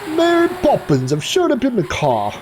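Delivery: put-on playful British nanny accent; Mary Poppins British. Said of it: "my first British accent ... Mary Poppins".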